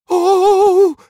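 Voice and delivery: high, loud startled cry. Acted. Scoop scared by the roaring triceratops, startled. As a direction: jump with fright. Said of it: "veel enger dan hij dacht".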